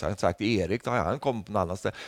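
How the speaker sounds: ordinary talk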